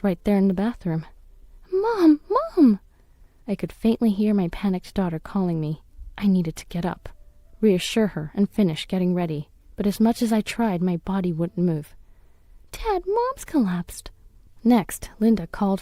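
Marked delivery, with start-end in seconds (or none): 1.7-2.8 high, loud frantic calls; panicked child calling. Acted. Daughter calling collapsed mom, panicked high shouts. 12.7-14.1 high, slow, shaken voice; frightened child. Acted. Daughter telling dad mom fell, frightened child voice.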